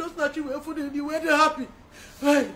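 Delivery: usual speaking voice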